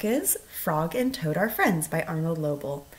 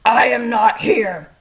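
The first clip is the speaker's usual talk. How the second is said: 1 sleepy grump; 4 semitones higher, 10 dB louder, slower than usual. A narrator's reading. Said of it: "said the voice".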